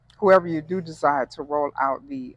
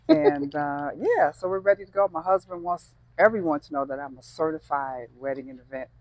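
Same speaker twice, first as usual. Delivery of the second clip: normal talking voice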